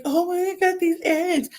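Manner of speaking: high, slow, sugary mimicry; drawn-out, high-pitched mock sweetness; mocking imitation. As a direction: imitate a rival sweetly offering something. Mocks rival bringing eggs.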